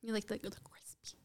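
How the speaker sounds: playful ASMR whisper. Pretend. Teasing about ASMR, whispers.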